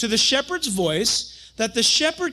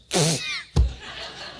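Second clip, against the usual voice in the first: high laugh. Retold or imitated. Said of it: "and she just goes".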